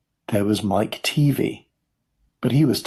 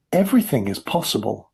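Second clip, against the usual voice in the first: plain reading voice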